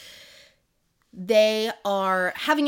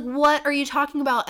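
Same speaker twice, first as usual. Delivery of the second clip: retold baffled retort; 7 semitones higher, 5 dB louder than usual. Acted quarrel, her baffled retort.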